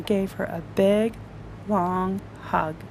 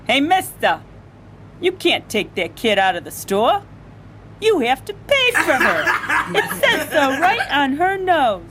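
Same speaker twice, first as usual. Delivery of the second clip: scolding cashier; 7 semitones higher, 4 dB louder than usual. Acted.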